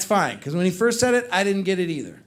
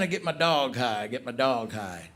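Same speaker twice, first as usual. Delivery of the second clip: big guy voice; 9 semitones lower, 8 dB quieter than usual. Retold or imitated. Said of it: "he's like".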